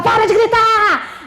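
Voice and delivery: teacher yelling at the class to stop yelling. Retold or imitated. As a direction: yell it like a frazzled teacher. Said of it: "Igual louco, gritando".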